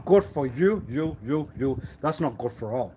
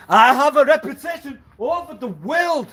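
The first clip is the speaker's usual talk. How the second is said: loud, higher proud boast; boastful claim; 11 semitones higher, 7 dB louder than usual. Retold or imitated. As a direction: puff yourself up and declare it loudly. Voicing a boastful reputation claim.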